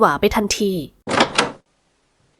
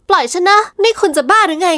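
higher, urgent, angry protest; 8 semitones higher than usual. Acted. Woman dragged off by the man, angry higher protest. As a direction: pull free and snap at him. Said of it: "พูดพอให้ได้ยินกันแค่สองคน".

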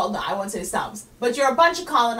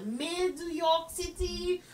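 put-on slow, pitched-up French accent; mock French; 6 semitones higher, 5 dB quieter, much slower than usual. French critic renames New York, mock French accent. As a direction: draw the name out in fake French. French voice suggesting city names.

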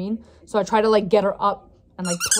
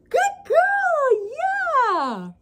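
squeaky praising a dog; much slower than usual. Real, not acted. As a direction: gush over the puppy, way up high.

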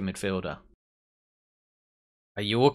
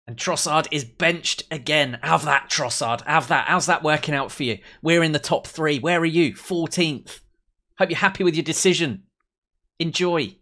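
raised, louder jeering at a rival; gloating taunt; 4 semitones higher, 4 dB louder than usual. Real, not acted. Gamer taunting Trossard and Tottenham, gloating. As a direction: rub it in, voice lifted.

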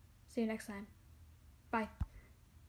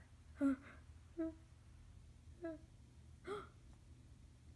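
high sobbing gasp; tearful gasp; 7 semitones higher, 4 dB quieter than usual. Acted. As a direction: gasp through tears. Character crying.

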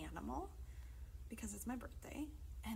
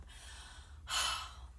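long breathy exhale; big sigh. Real, not acted. Leader finishing the wombat song, long sigh.

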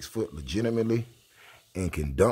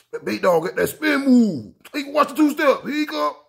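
high, excited play-by-play hype; mock announcer; 14 semitones higher, 6 dB louder than usual. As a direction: call his own move like a hyped commentator. Mock commentary of his move.

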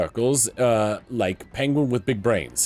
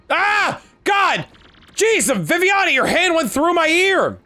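much higher, louder pained yelp; loud startled complaint; 6 dB louder, slower than usual. Acted. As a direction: cry out as if poked in the ear. Startled cry of pain.

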